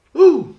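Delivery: loud high woo, shaking off the giggles; real breathless woo; laughing exhale. Real, not acted. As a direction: blow out the laugh. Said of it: "without laughing".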